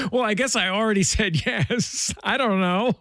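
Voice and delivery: high-pitched, laughing mock reluctance; sheepish amusement. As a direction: retell your reluctant yes in a high voice, laughing. Retold sheepish reply, host laughing.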